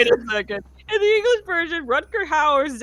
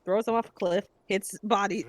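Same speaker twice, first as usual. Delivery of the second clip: normal talking voice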